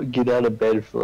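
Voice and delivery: normal talking voice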